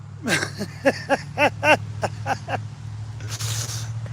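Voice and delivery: breathy high laugh; high giggling laugh. Real, not acted. Man laughing at his kitten's name. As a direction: giggle at your own joke.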